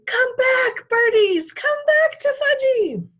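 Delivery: put-on very high, loud toddler scream. A narrator's reading. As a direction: shriek after the birds. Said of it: "was screaming".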